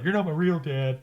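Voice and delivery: put-on bratty teenager snapping at stepdad; whiny "you're not my dad"; sulky teen. Teen brothers defying stepdad.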